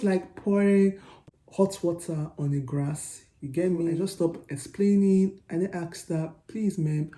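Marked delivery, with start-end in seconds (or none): none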